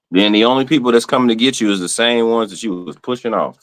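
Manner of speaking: ordinary talk, nothing marked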